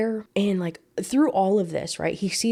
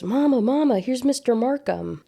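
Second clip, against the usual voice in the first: put-on child calling out; 6 semitones higher than usual. A narrator's reading. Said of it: "Arthur call out to him".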